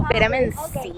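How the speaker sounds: normal talking voice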